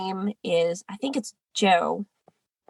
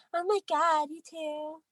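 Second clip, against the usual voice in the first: Rob; 7 semitones higher, 7 dB quieter than usual. Retold or imitated. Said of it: "Rob would be like".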